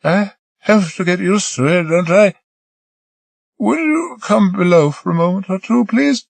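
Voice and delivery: a hint of Marie, coaxing; lightly voiced young woman. Marie coaxing Peter to come below, lightly voiced woman.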